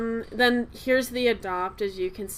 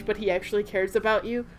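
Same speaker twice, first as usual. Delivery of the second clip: normal talking voice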